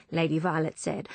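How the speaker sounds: ordinary delivery, nothing marked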